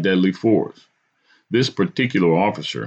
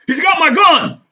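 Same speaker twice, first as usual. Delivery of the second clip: high, loud panicked shout; frightened yell; 7 dB louder than usual. A narrator's reading. Cop to fellow officers over grabbed gun, frightened yell. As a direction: yell out in alarm like a scared cop. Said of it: "hear the frightened cop yell".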